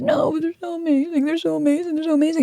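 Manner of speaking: high, sing-song gushing over the parents; mock gushing. Mocking clients who idealize parents.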